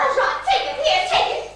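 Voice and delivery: angry shout. Acted. Catherine shouting at the nurse as she hands over the cigarette. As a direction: shove it at her, shouting. Patient burns nurse in quarrel.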